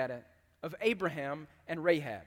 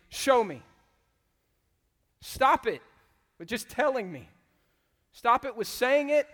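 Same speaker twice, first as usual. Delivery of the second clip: higher, loud, stern rebuke; stern demand; 4 semitones higher, 7 dB louder than usual. Retold or imitated. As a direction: scold the congregation hard. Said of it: "James is saying to his congregation".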